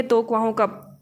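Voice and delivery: ordinary talk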